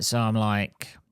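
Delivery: ordinary delivery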